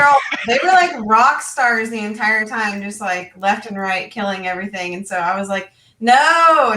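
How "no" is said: loud, high, drawn-out "no"; mock dismayed cry. GM lamenting players who never crit fail, mock dismayed cry. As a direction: wail it in exaggerated frustration.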